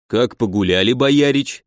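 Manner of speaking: usual speaking voice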